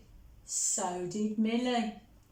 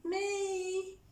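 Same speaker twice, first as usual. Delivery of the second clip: much higher, small teary squeak; a tiny hurt whimper; mock whimper; 10 semitones higher, 4 dB quieter than usual. Upset Millie answering her brother, mock whimper.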